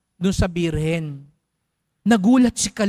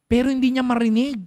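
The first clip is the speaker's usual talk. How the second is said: ordinary talk, nothing marked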